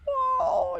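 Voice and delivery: very high, loud startled whoa; bird's startled cry. Acted. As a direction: yelp like a chick landing in a mess. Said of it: "and he was".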